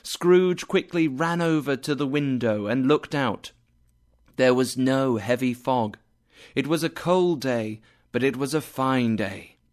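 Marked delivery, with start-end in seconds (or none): none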